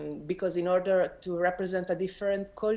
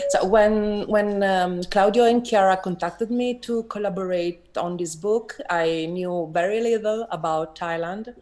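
louder, projected voice on request; speaking up; 2 semitones higher, 7 dB louder than usual. Real, not acted. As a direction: raise your voice so the room can hear. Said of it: "speak up a little bit louder".

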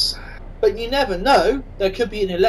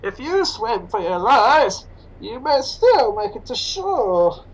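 slow, masked villain voice; slow villainous masked drawl; Bane impression; 3 semitones higher, slower than usual. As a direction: menace it out slowly like Bane. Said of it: "Perhaps if I said it as Bane".

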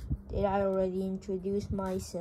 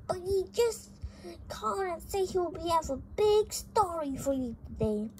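high voice, rushed and chirpy; squeaky fast messenger; 8 semitones higher, faster than usual. Acted. Office worker passes on the grandfather's news in a fast, high voice. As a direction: squeak it out quickly. Side character relays grandfather's message.

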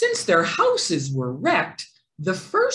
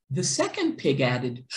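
usual speaking voice

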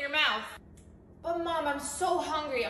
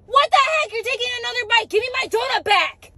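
put-on high, loud, outraged kid yell; angry yell; 8 semitones higher, 9 dB louder than usual. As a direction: shout at the donut thief. Kid yelling about donut.

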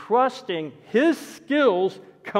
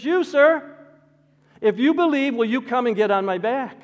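daredevil's challenge; 7 semitones higher, 6 dB louder than usual. Retold or imitated. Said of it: "he says".

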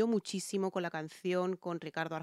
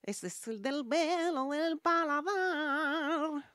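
lightly held nasal mock voice, higher; still in nasal imitation; 9 semitones higher, much slower than usual. Shown as a demonstration. Coach stays in the imitated nasal voice. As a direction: keep the singer's nasal tone while explaining. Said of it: "Tú siempre me respondes".

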